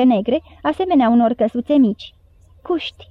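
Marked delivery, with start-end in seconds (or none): 2.6-3.1 soft breathy whisper in the dark; hushed whisper of one word. A narrator's reading. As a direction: whisper it so the beasts don't wake. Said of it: "șopti Suoc".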